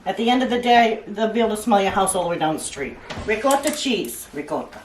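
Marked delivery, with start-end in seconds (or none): 4.3-4.8 little Italian accent. Shown as a demonstration. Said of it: "I'm trying to throw in a little accent there".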